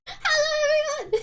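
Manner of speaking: hello said through giggles, pitched high; laughing hello. Real, not acted. Streamer greeting chat while still giggling, laughing.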